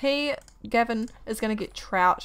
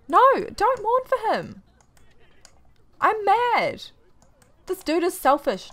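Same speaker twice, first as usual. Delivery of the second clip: shrill-free but pitched way up in mock fury; real anger; 9 semitones higher, slower than usual. Real, not acted. Gamer yelling at her sim to stop mourning, high and exasperated.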